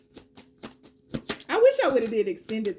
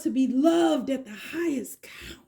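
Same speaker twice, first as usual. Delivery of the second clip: whispered lyric; 2 semitones higher than usual. Real, not acted.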